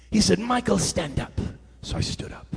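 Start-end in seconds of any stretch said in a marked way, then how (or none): 0.4-1.4 imitating bishop. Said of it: "That's what he sounds like".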